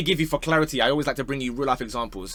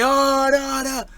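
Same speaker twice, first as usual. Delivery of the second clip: higher, drawn-out mock "oh no" of a disappointed crowd; imitating crowd groan; 10 semitones higher, 4 dB louder, much slower than usual. Mimicking the stadium crowd reacting to the penalty, mock groan. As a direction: groan like a whole stadium at once. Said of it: "And then the crowd say".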